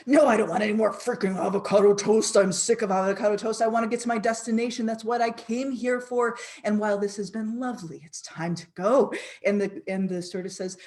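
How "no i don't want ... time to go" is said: louder, exasperated refusal; fed-up outburst. Retold or imitated. Traveller fed up with avocado toast, louder and exasperated. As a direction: snap at the stewardess in frustration. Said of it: "you throw it and you say".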